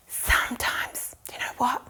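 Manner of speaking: whispered storytelling aside; very soft, voiceless confiding; hushed whisper. Acted. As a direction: whisper it like a secret. Confiding in the children mid-story.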